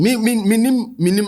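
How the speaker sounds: usual speaking voice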